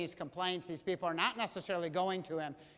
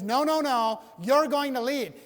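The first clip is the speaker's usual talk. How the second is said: louder, insisting; firm God; 7 semitones higher, 12 dB louder than usual. Retold or imitated. God insisting to Moses that he will lead, louder. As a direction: overrule the objection. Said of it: "He says".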